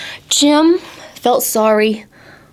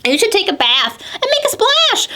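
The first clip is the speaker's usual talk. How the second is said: much higher animal voice; a bubbly high creature; 11 semitones higher than usual. Acted. An animal telling Jim to splash, high.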